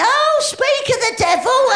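slow, high, loud exclamation; drawn-out mock cry of surprise; mock shout. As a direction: blurt it out at the Pope, hammed up. Greeting the Pope aloud.

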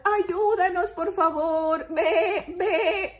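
high pleading bleats; sheep crying out. Acted. Little sheep begging to be saved, crying out. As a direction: beg and bleat loudly. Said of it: "Todas están así gritando".

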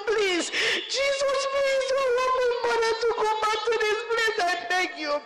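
high, pleading cry to God; desperate prayer. Retold or imitated. Daughter pleading with Jesus for her lost mother, high and desperate.

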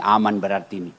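low, soft thought to himself; inner thought, hushed. Acted. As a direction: mutter it under the breath. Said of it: "Kata suami dalam hati".

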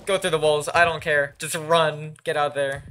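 loud, high panicked run run; panicked shouting. Real, not acted. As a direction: yell at yourself to flee. Fleeing the warden.

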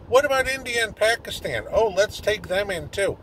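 mocking, high-pitched singsong exasperation; sarcastic mimicry. Sarcastic mock leaders' open-door exchange.

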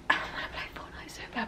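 fast, hushed aside; whispered confession. Real, not acted. Admitting she misses Fortnite, whispered. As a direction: whisper it quickly under your breath. Aside undercutting her brave claim.

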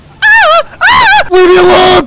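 very high, loud shrieks; frantic high-pitched game panic; panicked screaming. Real, not acted. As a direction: scream to be left alone. Screams under boss attack.

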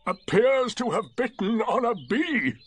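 slow, high, sardonic donkey voice; Eeyore. A narrator's reading. Donkey commenting on the yelping tiger, slow and dry. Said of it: "said Eeyore".

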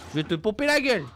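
loud swaggering threat; cocky threat. Pretend. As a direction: taunt the zombie, boastful. Threatening zombie with shotgun.

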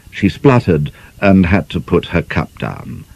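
plain reading voice

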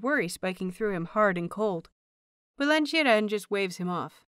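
plain reading voice

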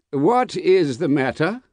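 high, slow, faintly anxious question; mildly quavering. A narrator's reading. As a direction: ask with a slight quaver. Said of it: "with mildly quavering speech".

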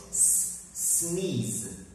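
the S hissed alone, then "sneeze"; initial S demonstrated. Shown as a demonstration. Teacher shows how the word begins, isolated S then "sneeze". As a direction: isolate the starting S sound before saying "sneeze". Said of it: "See how the S in the beginning goes".

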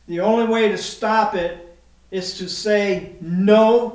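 "no" hit hard and loud at the end; stress on no. Real, not acted. Preacher urging the congregation to refuse Satan, punching "no". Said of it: "I emphasize the word no".

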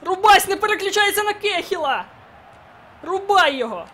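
high, loud exasperated yell; frustrated shout. Real, not acted. Gamer shouting at his defender.

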